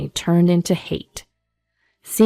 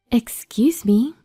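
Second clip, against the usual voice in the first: higher, slow, polite interjection; polite interruption; 5 semitones higher, slower than usual. Acted. Unfamiliar woman interrupting the arguing exes, polite. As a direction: cut in politely from behind.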